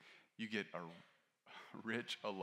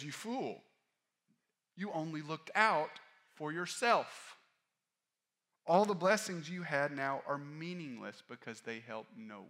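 raised, slow scolding of the fool; stern rebuke; 7 semitones higher, 8 dB louder than usual. Acted. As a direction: rebuke him loudly and slowly as Jesus. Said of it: "You could say it like this".

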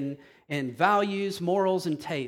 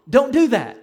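loud, stern, higher warning; 6 semitones higher, 9 dB louder than usual. Real, not acted. Preacher warning his flock, loud and stern. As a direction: snap a warning at the congregation.

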